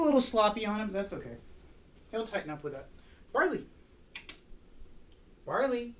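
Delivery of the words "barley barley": high dog call. Real, not acted. Calling dog to do touch.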